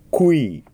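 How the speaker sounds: クイ gliding from ウ into イ; ウ then イ. Shown as a demonstration. Teacher demonstrating the qui glide. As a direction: move from ウ to イ in クイ. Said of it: "それからイに変えます".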